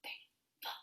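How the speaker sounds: very faint, hushed count; whispered counting. Shown as a demonstration. Music teacher keeping time while pupils play, whispering.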